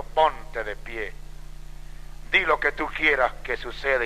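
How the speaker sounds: low, soft, measured voice of God; hushed divine command. Retold or imitated. God speaking from the pillar of fire, hushed command.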